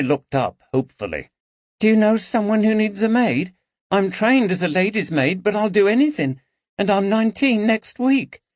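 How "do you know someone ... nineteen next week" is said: put-on a much higher, hopeful girl's voice; eager young maid. A narrator's reading. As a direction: plead for work in a rush of hope. Harriet pleads for work.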